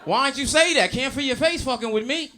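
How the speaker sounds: high, loud, laughing dealer voice; light up like he just found a customer; a hint of a grinning street dealer; excited dealer. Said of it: "He's like".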